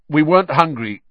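loud, high denial. A narrator's reading. Boy covering for his rumbling stomach, loud. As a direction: blurt it out loudly. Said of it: "said Ron loudly".

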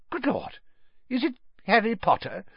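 high, hushed amazement; astonished. Acted. Father suddenly seeing the famous guest, astonished. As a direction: gasp it out in startled wonder.

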